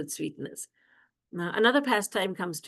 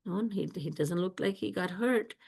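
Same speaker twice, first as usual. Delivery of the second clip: put-on hushed whisper, voiceless, as if checking on a child; 6 dB quieter than usual. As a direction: breathe it out without voice, softly assessing. Mother checks child quietly.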